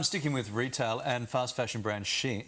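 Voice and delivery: ordinary delivery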